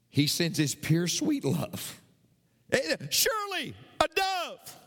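normal talking voice

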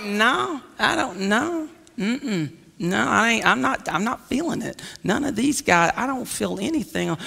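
low, quiet, doubtful musing; soft, low reluctant turn-down; unimpressed prophet. Acted. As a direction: murmur it to yourself, unconvinced. Prophet unimpressed by the seven sons.

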